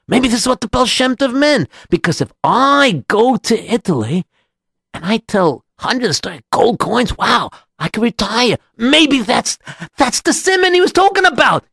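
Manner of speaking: high-pitched, rising thrill; mounting excitement. Acted. Rabbi imagines earning gold coins in Italy, mounting excitement.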